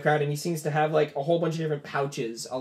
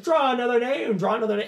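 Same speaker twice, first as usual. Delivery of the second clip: high-pitched crowd chant of demand; yelling; 10 semitones higher, 5 dB louder than usual. Acted. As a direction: heckle the host from the crowd. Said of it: "they start yelling, like".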